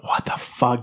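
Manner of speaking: under-breath whispered curse; whispered what the fuck. Retold or imitated. Office worker cursing under his breath. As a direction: whisper it in disbelief. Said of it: "rubbing my head, like".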